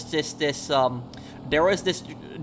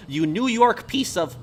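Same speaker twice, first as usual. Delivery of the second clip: higher, drawn-out imitation of a Southern yell; mock Southern accent; 6 semitones higher, slower than usual. Streamer retelling a trucker yelling at him, imitated Southern accent. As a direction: lightly put on a slow Southern accent. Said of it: "a very thick Southern accent".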